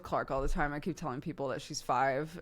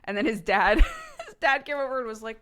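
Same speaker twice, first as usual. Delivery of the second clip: ordinary talk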